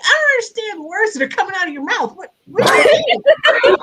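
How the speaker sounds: squeaky-high comic outrage; retold bafflement. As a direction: reenact total disbelief, pitch way up. Retelling bafflement at the news.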